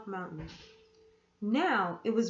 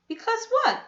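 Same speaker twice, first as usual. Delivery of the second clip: put-on insistent boy; 13 semitones higher, 8 dB louder than usual. A narrator's reading. Boy presses aunt.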